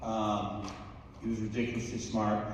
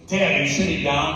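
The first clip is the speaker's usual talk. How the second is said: retold son's urgent question, raised and louder; urgent grave question; 6 semitones higher, 7 dB louder than usual. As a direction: voice the son's alarming call, pitched up. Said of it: "I've never heard him talk like that".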